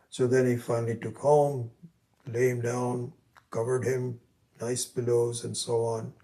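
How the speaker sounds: normal talking voice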